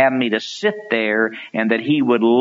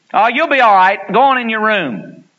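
much higher, louder brush-off; dismissive mom; 9 semitones higher, 6 dB louder than usual. Retold or imitated. Mother brushing off her bullied second grader, dismissive. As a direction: wave the child away breezily.

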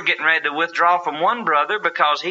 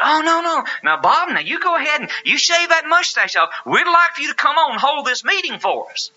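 high, eager backpedaling voice; sudden high eager agreement; eager elder; 13 semitones higher than usual. Retold or imitated.

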